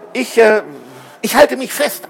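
stumble, then high and insistent; hesitate, then reassure hastily; hesitant answer. A narrator's reading. Hesitant answer about own safety.

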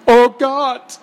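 loud, high groan of dismay; horrified groan. A narrator's reading. Headmaster hears of the children's capture, groaning. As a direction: groan in high-pitched horror. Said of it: "stöhnte er".

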